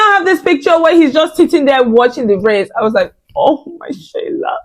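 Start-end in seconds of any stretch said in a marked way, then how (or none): 3.3-4.6 much higher, slow mock-crying; hammed-up wail. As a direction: wail it like a dramatic fan. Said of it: "I was genuinely so upset".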